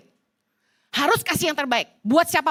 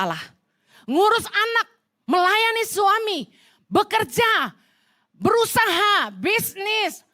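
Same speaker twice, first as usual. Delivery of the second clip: full-voiced pulpit fervor; fervent preaching; 6 semitones higher than usual. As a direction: preach it, a little higher each line. Preaching builds up.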